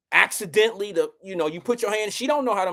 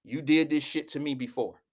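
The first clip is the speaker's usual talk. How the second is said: low reproach; 5 semitones lower, 5 dB quieter than usual. Retold or imitated. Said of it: "I said".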